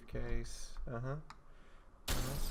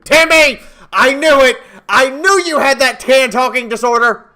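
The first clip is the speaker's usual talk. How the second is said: mock scolding; 8 semitones higher, 11 dB louder than usual. Scolding game child playfully.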